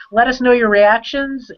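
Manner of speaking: usual speaking voice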